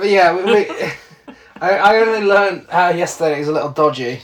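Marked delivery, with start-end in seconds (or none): 0.0-2.7 laughing. Real, not acted. Laughing after accent jokes.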